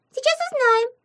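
very high, loud little voice; bold squeaky imp. A narrator's reading. Imp about to find out, bold high squeak.

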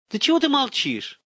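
high, frustrated demand; exasperated high-pitched plea; desperate urging. A narrator's reading. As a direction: burst out, pressing for an answer. Father desperate at the silence.

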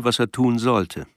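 usual speaking voice

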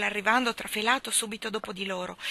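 plain reading voice

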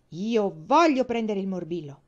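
loud, rebellious insistence; defiant child digging in. A narrator's reading. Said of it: "mormorò da ribelle".

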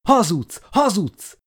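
very high, loud hysterical scream; sobbing scream. Acted. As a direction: shriek the denial through tears. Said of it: "sikoltott zokogva". (zokogva sikoltva)

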